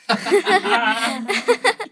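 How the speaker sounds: giggling burst, fairly soft; soft burst of giggles; real laughter. Real, not acted. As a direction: laugh along at the silly pun. Laughing after the crocodile joke.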